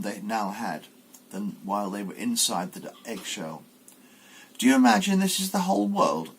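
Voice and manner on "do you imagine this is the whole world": mother duck. Acted. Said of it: "asked the mother".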